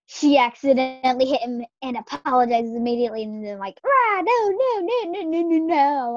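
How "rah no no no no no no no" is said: high squeaky no no no; playful pleading dragon voice; mock dragon protest. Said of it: "I'm trying to be intimidating".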